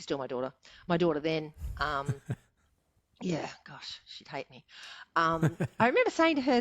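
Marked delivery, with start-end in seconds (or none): 1.8-4.6 soft laughing whisper, half to herself; whispered aside. Real, not acted. Guest whispering an aside about her daughter, soft and laughing. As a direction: breathe it as a guilty little aside.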